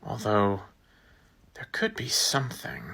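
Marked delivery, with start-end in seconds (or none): 1.5-2.8 whisper. Acted. Hesitant hushed idea.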